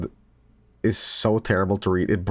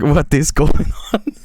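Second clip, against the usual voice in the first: louder, bewildered cry; mock confused outburst; 5 semitones higher, 7 dB louder, slower than usual. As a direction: play a baffled man, loud. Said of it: "he's like".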